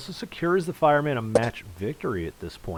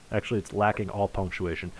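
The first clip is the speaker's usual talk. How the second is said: ordinary talk, nothing marked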